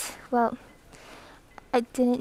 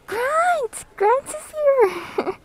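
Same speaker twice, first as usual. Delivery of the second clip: high, slow excited squeal; excited shout; 11 semitones higher, 4 dB louder, much slower than usual. Real, not acted. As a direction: squeal with delight. Greeting a viewer excitedly.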